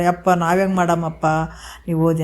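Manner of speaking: ordinary talk, nothing marked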